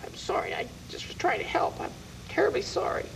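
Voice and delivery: sheepish whisper of sorry; whispered apology. Acted. Witch apologizes to Ollie for her remedy, whispering. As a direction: back off in a hushed whisper. Beulah hushed after Ollie's rebuke.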